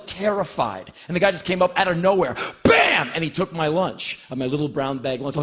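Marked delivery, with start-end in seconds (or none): none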